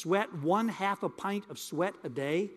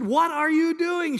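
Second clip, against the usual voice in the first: "What are you doing?" higher and louder, each word drawn out; louder demanding bride; 9 semitones higher, 7 dB louder, slower than usual. Retold or imitated. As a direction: lean in and demand it, alarmed. She got close and said.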